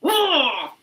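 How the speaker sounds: loud, high, whooping delight; elated celebration. Real, not acted. Vlogger celebrating that things work, elated shouting. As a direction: cheer and squeal with real joy.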